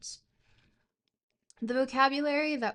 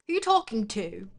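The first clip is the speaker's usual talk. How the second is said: louder mock British challenge; hammed-up British accent; 5 dB louder than usual. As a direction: challenge them as a put-on Brit. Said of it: "speak in a British accent".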